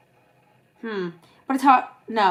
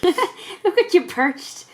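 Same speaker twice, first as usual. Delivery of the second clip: high, slow, through laughter; laughing tease; 9 semitones higher, much slower than usual. Real, not acted. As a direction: giggle it out teasingly. Laughing at mum.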